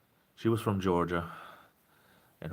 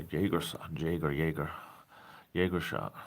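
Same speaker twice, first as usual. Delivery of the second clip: whisper; 3 semitones lower, 6 dB quieter than usual. Real, not acted. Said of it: "unvoiced whisper".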